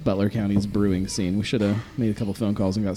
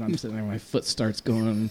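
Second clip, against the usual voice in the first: ordinary talk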